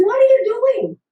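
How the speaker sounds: high, loud disbelief; incredulous coach. Retold or imitated. Coach yelling at a flailing swimmer, high and loud. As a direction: coach yelling across the pool.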